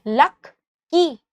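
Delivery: "लक की" as two detached syllables, pausing between them. Shown as a demonstration. Teacher asks students to count syllables, "लक की" said in separate beats. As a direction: break the word into लक... की. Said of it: "बोल के देखो।".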